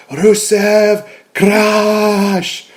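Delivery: high, drawn-out battle-cry bellow; Rusev catchphrase. Retold or imitated. Fan recapping a match mimics the wrestler's signature call, drawn-out chant. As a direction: stretch it out like a wrestler's war cry. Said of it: "of course, does, you know, his".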